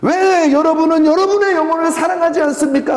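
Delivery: high, loud imitation of a weeping pastor's cry; impassioned tearful outcry. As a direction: wail the question at the congregation. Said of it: "하고 우시더라고요".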